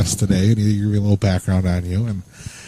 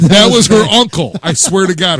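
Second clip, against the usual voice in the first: higher, louder insistence on "was"; loud protest; 7 semitones higher, 7 dB louder than usual. Real, not acted. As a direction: protest loudly, leaning on "was". Insisting after being teased.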